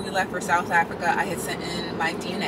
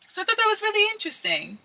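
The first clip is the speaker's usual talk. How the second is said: excited; 10 semitones higher than usual. Real, not acted. Excited wrap-up question to viewers.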